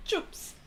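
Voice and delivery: "Chumps" said softly as "chups"; mock-Kiwi "Chumps" for chips; attempted Kiwi vowel. Shown as a demonstration. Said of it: "not even saying it right".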